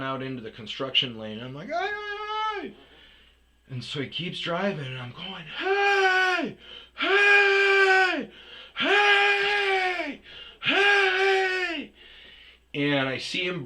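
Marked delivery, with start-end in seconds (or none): 1.7-2.7 high-pitched drawn-out holler; calling out. Retold or imitated. Man flagging down a delivery driver, high holler. 5.6-11.9 loud, high, long-held yells; shouting after someone. Retold or imitated. Man chasing his delivery van, high loud shouts.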